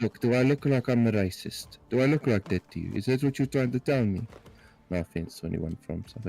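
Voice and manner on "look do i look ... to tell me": higher, louder indignant comeback; mock indignation. Streamer replaying a retort to being asked about South Africa, mock indignant. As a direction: fire it back in mock offence, raised voice.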